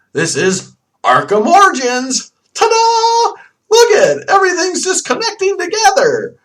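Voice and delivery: high, loud, over-the-top ta-da; mock excited reveal. Vlogger presents the Titan link, mock excited reveal. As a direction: sing out a hammed-up big reveal.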